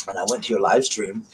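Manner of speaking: ordinary talk, nothing marked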